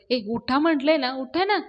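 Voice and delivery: sharp, forceful wake-up shout. Acted. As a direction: yell at sleepers to get up. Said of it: "यशा ओरडतो".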